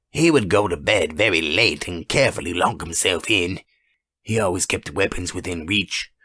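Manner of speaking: plain reading voice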